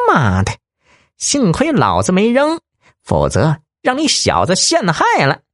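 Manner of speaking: high thin voice, rushing; a squeaky crook caught out and flustered; anxious thin-voiced bandit. Thin-voiced bandit defending himself, high and rattled. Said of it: "尖细嗓子急了".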